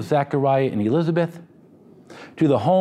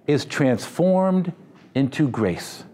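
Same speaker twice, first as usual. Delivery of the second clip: slowed right down, landing on grace; slow emphasis; slower than usual. Real, not acted. Pastor slowing to plant the key phrase in memory. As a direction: spell it out word by word. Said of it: "I hope you remember that phrase".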